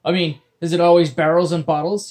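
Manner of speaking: loud, curious pirate. Acted. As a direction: ask it like a genuinely puzzled pirate. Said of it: "legitimate curiosity filling his words".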